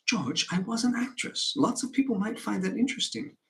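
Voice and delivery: earnest, high-voiced appeal to a husband; pleading wife.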